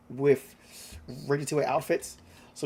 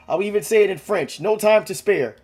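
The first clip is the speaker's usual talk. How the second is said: high, loud, frantic repeat; frantic insistence; 7 semitones higher, 8 dB louder than usual. Acted. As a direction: hammer the point home in a panic. Frantic, claims French.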